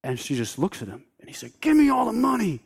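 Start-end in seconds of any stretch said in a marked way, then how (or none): 1.6-2.6 robber's shout. Retold or imitated. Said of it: "he's pointing his gun at her".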